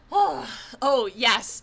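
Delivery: ordinary talk, nothing marked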